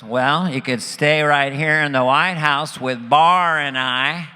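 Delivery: slow, drawn-out presidential impression; George Bush. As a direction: offer hospitality as the folksy president. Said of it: "He goes".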